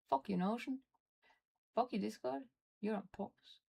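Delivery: ordinary talk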